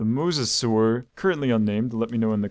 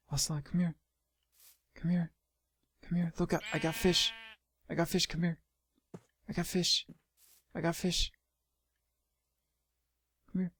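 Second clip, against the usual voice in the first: higher, softer pet-coaxing voice; 6 semitones higher, 8 dB quieter, faster than usual. Real, not acted. Calling the ocelot over, soft high coaxing. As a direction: lure the animal gently with a treat.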